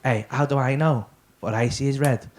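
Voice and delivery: low dazed stoner voice; stoned mate. Retold or imitated. Stoned student asking his mate about a colour, low and dazed.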